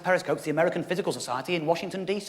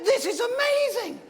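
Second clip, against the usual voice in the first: very high, excited questioning; pitched-up teasing pestering; eager friends; 12 semitones higher, 3 dB louder than usual. Retold or imitated. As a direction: push him, thrilled. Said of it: "But I said".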